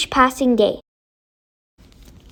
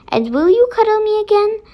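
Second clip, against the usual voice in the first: much higher, quick, hopeful plea; pleading boy; 8 semitones higher, faster than usual. Acted. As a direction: beg like a lonely little boy. Tom begs the lady.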